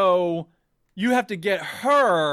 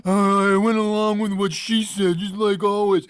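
mock resigned compliance; 3 dB louder than usual. Player giving in to the friend as always, mock resigned drone. Mimics own meek giving in.

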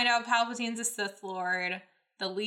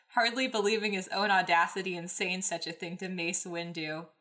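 ordinary talk

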